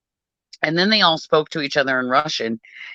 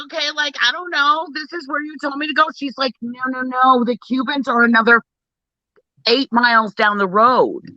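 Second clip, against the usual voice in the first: higher, exasperated retelling of the call; hint of a stressed-out phone argument; raised retelling voice; 8 semitones higher than usual. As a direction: pitch it up in disbelief. Voicing both sides of call.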